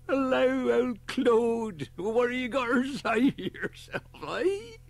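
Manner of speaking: high and loud, teasing his bird; old man jollying his pet pigeon; cheery old man greeting. Acted.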